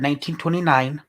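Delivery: ordinary talk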